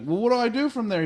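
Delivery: high, fast, panicky mock plea; pleading teen. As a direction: rush the question like a lost kid. Wesley pleads with Geordi.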